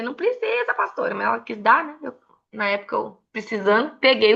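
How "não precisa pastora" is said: high, coy protest; a touch of a shy young woman; mock polite refusal. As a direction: play down the gift sweetly. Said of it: "Eu falei".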